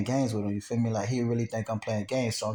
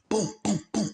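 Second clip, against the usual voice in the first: high, punched booms; imitated door banging; 9 semitones higher than usual. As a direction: mimic fists pounding on a door. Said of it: "beating on the door".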